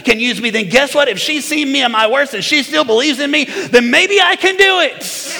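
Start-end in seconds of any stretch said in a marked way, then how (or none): 3.5-5.4 high, slow, full-voiced cry of hope; preaching shout. Real, not acted. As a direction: lift it to a triumphant shout. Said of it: "preaching climax".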